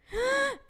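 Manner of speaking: sudden high delighted gasp; quick intake of breath, pitched high with delight; sharp gasp. Real, not acted. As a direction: gasp as if you just spotted something adorable. Excited gasp at a capybara.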